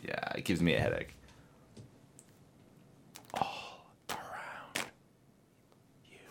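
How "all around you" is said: slow whisper. Shown as a demonstration. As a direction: draw it out in a whisper. Repeats the whisper bit.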